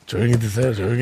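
low, soft aside; quiet, low request; quiet deadpan. Real, not acted. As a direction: hush them in a low, softened voice. Deadpan hush after loud toast.

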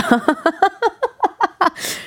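high, rippling giggle; laughing. Real, not acted. Host amused by her own torn-skirt schoolgirl story, giggling. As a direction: burst into a long giggle.

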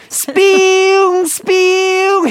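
high, slow zapping "spee-woong" noise; sci-fi zap sound. Retold or imitated. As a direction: squeal it like a laser. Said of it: "맴맴이요".

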